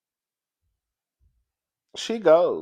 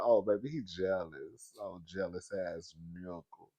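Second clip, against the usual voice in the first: soft slow aside; 7 semitones lower, 8 dB quieter, slower than usual. Retold or imitated. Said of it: "I said".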